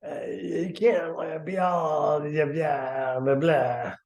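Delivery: muffled mock shout over the shoulder, slowly; turned-away shout. Shown as a demonstration. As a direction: shout back over your shoulder, slow and half-hearted. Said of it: "This is me trying to shout over my shoulder".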